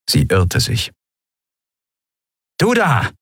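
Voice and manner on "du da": high, sudden call; sharp challenge. A narrator's reading. Stranger behind Nelen, challenging her sharply. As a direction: halt them with a raised voice.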